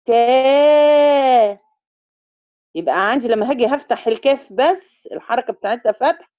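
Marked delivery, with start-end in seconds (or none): 0.0-1.6 كا with the a held long; stretched long a. Shown as a demonstration. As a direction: draw out كا slowly. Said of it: "لو أنا هقول كا".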